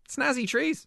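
playful. Real, not acted. Playful quip.

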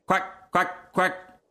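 short, high duck quacks; duck quacking. A narrator's reading. Duck swimming away downstream, quacking. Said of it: "swam away down the stream, saying".

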